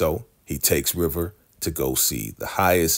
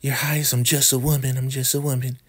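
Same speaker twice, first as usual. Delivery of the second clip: mock sweet woman; 9 semitones higher, faster than usual. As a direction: coo his name like a demure lady. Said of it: "And she's like".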